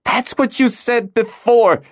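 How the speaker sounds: put-on high, slow girl's voice, exasperated; frustrated exclaim. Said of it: "Hala exclaimed, her frustration evident".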